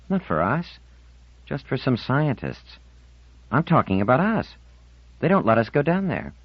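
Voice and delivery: put-on a touchy fifteen-year-old digging in; young girl's voice, defensive; defiant teen girl. Marlena protesting.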